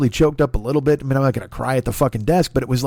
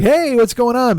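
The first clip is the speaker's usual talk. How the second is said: high, louder jovial hey; over-jovial greeting; 9 semitones higher, 5 dB louder than usual. Acted. Big guy overdoing it at a pretty desk clerk, loud jovial greeting. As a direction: call it out loud and chummy. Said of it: "didn't wanna be like".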